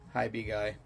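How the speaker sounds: emotionless, low and soft greeting; Jensen. Retold or imitated. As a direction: imitate a deadpan hello, quiet and low. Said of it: "like emotionless".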